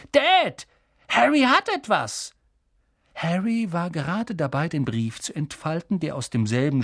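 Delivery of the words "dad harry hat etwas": put-on high-pitched urgent boy's voice; excited tattling. Son telling Dad that the boy has something, high and urgent. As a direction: tell on him, very high.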